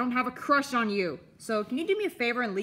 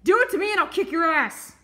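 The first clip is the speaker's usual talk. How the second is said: angry threat; 7 semitones higher, 6 dB louder than usual. Acted. Threat shouted.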